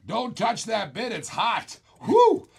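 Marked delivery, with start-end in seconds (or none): 2.0-2.5 sharp high whoop of excitement; hot-bid whew; loud high whistle-like whew. Real, not acted.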